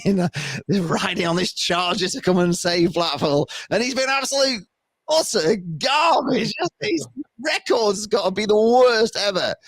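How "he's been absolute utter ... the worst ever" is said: high-pitched, exasperated outburst; exasperated rant. Real, not acted. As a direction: rant it out in disbelief, voice shooting up. Exasperated rant about the manager.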